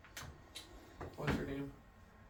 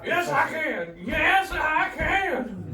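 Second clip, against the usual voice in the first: shouting; 10 semitones higher, 7 dB louder, much slower than usual. Real, not acted. Excited shouting back at friends.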